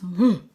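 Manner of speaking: sharp loud gasp; a quick startled intake of breath; sudden gasp of fright. Acted. Narrator reacts to octopus.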